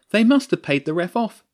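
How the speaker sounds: high, loud protest; indignant complaint. Acted. Louisa grumbles to the team about the ref, indignant. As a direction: complain loudly, pitched well up.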